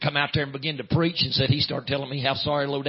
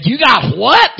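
loud, high yelp of disbelief, swooping up on "what"; acted shocked outburst; incredulous; 10 semitones higher, 13 dB louder, much slower than usual. Mother woken by son's news, acted incredulous outburst. Mother hears son got saved.

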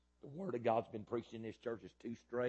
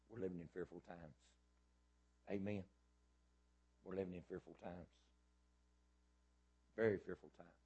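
hushed preaching; 4 dB quieter than usual. Acted. Preacher drops to low intensity.

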